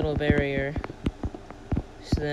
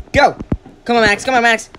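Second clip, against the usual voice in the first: cheering on a fight; 5 semitones higher, 9 dB louder than usual. Real, not acted.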